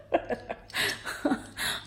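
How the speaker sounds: breathy, voiceless chuckle; amused laugh. Real, not acted. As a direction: laugh it off softly. Laughs at herself.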